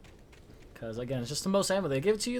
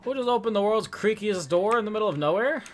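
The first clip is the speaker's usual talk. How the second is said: startled loud outburst; 9 semitones higher, 8 dB louder than usual. Real, not acted. Startled by a creaky door.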